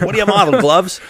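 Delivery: commercial guy. Pretend. Podcast host spoofing a snack commercial to his guest, mock ad voice. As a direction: ham up a candy bar commercial actor. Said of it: "a Mars bar commercial with the guy's like".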